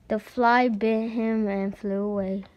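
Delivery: ordinary delivery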